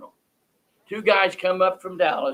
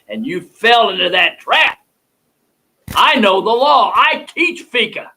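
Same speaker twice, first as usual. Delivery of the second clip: higher and louder, defiant and slow at the end; defiant anger; 5 semitones higher, 8 dB louder than usual. Real, not acted. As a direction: hammer home that you know the law. Host defies the prosecutor.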